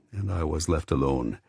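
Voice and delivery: normal talking voice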